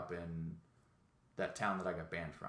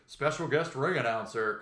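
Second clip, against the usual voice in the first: loud, higher announcer call; mock ring announcer; 5 semitones higher, 7 dB louder than usual.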